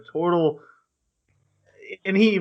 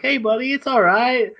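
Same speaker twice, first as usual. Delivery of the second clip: high, friendly pat-on-the-back voice; imitating a friendly knight consoling him; mock buddy voice; 11 semitones higher, 5 dB louder than usual. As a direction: play the knights, high and chummy. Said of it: "they're like".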